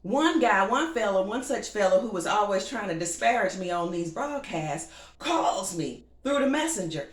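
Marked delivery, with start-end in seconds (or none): none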